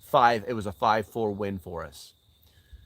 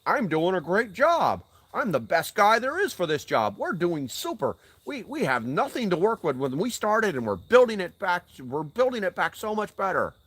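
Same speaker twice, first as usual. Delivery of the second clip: higher, boastful mock official voice; mocking the Homeland Security director, higher pitched; 7 semitones higher than usual. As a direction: parody a smug bureaucrat bragging. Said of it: "He's telling you, all day long".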